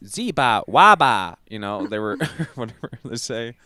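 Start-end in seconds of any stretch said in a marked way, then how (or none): 0.1-1.4 nonsense syllables, the second much louder; imitated Furby gibberish. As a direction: burst out with toy babble. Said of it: "they were just doing the".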